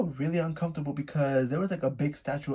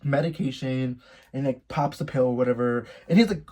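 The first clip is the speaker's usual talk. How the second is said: ordinary talk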